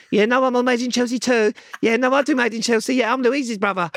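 high-pitched, loud mimicry of an eager name-dropper; mock him bragging to impress someone; squeaky-high mock boasting; mocking co-host imitation.

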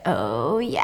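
very low, soft drawn-out murmur; engrossed relish. Acted. Nosy neighbor into the footage over lunch, engrossed relish. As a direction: say it low and quiet, savoring.

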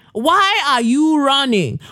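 slow, drawn-out high call. Pretend. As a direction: stretch the call out. Slower repeat of the call.

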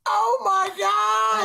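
a long amazed oh my God; stunned. Real, not acted. Reacting to the cab typo in slow amazement.

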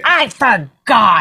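slow, drawn-out mock excuse; mocking imitation. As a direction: mimic them with an exaggerated, dragged-out delivery. Mimics partner's defensive excuse.

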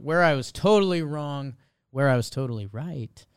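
normal talking voice

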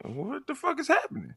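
high, shocked disbelief. Retold or imitated. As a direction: blurt it out in total shock. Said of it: "I'm like".

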